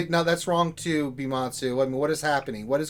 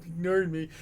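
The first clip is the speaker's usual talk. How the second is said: mock whine; 2 semitones higher than usual. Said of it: "come crying to us".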